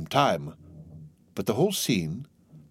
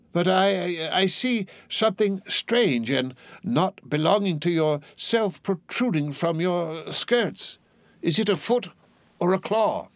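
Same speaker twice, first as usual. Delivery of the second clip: high, halting, fearful stammer; frightened old man; 8 semitones higher than usual. A narrator's reading. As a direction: stumble over the words in dread. Scrooge frightened.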